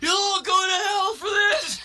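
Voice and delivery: high outraged shout rising to a shriek. Acted. Boy hauled out of class in a tantrum, shouting. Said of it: "while he's shouting".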